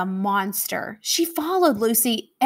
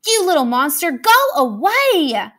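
crying out; 5 semitones higher, 6 dB louder than usual. Acted. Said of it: "Lucy cried".

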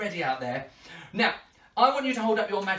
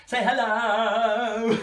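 slow, stretched singsong greeting; drawn-out call; 5 dB louder, much slower than usual. Real, not acted. Entertainer calling to birthday children, long singsong greeting. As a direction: stretch out the hello.

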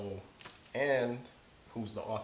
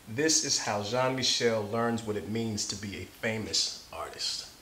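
story narration; 2 semitones higher, 6 dB louder than usual. Acted. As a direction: read it like to a class of kids. Reads picture book aloud.

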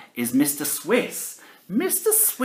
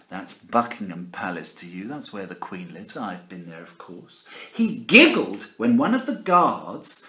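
ordinary delivery, nothing marked

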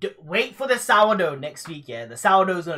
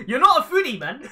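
high, loud laughing jab; laughing out the words; laughing tease; 8 semitones higher, 7 dB louder than usual. Real, not acted. Teasing co-host after a bite.

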